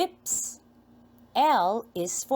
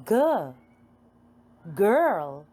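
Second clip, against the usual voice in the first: normal talking voice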